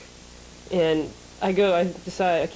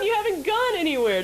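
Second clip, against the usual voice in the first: high, indignant disbelief; incredulous; 12 semitones higher than usual. Retold or imitated. Telling the cabbie he hasn't gone anywhere, incredulous. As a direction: protest the cab fare in disbelief. Outraged at cab fare.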